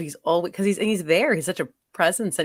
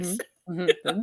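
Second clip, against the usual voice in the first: ordinary delivery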